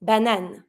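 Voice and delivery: banane with a nasal first syllable; French word banane. Shown as a demonstration. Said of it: "we're saying".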